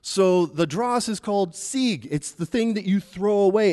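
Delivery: ordinary talk, nothing marked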